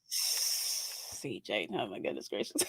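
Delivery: very soft, low near-whisper; hushed dismay. Real, not acted. Co-host reacting to CJ, hushed and low. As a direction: drop almost to a whisper. Aghast aside to CJ.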